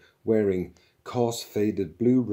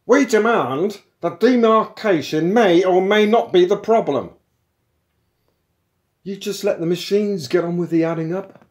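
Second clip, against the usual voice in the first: loud, high, aggrieved shop steward; militant union man; 9 semitones higher, 8 dB louder than usual. Acted. Philosophers protesting a machine taking their jobs, loud and aggrieved. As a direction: harangue them, voice raised throughout.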